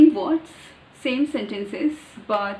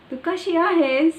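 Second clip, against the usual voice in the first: high, loud sing-song Marathi village accent; 7 semitones higher, 6 dB louder than usual. Shown as a demonstration. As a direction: lift and push it like a rural Marathi villager. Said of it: "like the village people say".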